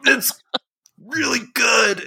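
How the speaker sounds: high, drawn-out put-on voice; forcing it down. Sister insisting the cheese apple is good, strained imitation. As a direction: imitate someone straining to praise awful food.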